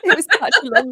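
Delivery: laughing through the words. Real, not acted. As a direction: giggle while you say it. Laughing about her tiring day.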